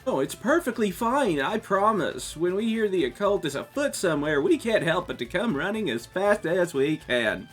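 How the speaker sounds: high, keen club president; eager girl voice. Acted. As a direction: lilt it out with zeal.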